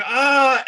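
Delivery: high roaring battle yell; devil's charging roar; devil war cry. Acted. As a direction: yell as the pike comes up. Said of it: "he's like".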